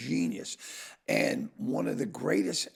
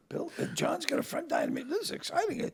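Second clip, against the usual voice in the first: retold excitement; 14 semitones higher, 4 dB quieter than usual. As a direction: share thrilling news in a raised pitch. Retold excited news of invitation.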